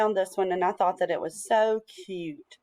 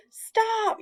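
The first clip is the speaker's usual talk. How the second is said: high-pitched narrated yell; 13 semitones higher than usual. A narrator's reading. Old man finally catching up, high mild yell. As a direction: call "stop" out high, storybook style. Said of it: "yelled the old man".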